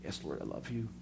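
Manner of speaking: almost under the breath; meek reply. Acted. Peter answers Jesus quietly and humbly. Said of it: "Peter answers Jesus".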